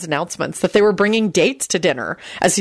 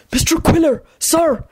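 high, frantic plea; panicked voiced stage whisper; 10 semitones higher than usual. A narrator's reading. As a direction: appeal urgently, pitched up. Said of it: "he whispered frantically".

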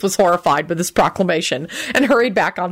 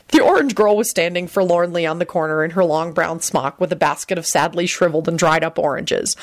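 ordinary delivery, nothing marked

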